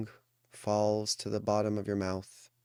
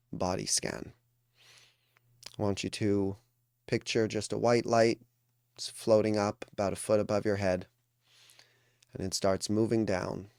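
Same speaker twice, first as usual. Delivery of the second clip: ordinary talk, nothing marked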